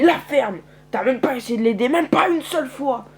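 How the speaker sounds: loud, high accusing shout; angry accusation. Acted. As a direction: blame someone furiously, rising at the end. Farmer blames narrator for death.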